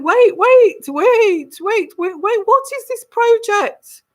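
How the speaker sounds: very high, urgent royal protest; hammed-up alarmed monarch calling a halt; the Queen. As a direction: cut in repeatedly in a high, imperious voice. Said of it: "the Queen is saying".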